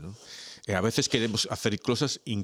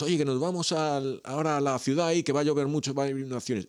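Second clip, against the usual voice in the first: neighbor calling out; 7 semitones higher than usual. Acted. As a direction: play the worried neighbor warning him, pitched up. Neighbors voiced in flood joke.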